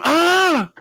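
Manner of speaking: short high shriek, loud; guard's scream. Retold or imitated. Guard finding him hanging, loud high shriek. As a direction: let out a startled high scream. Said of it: "and she scream".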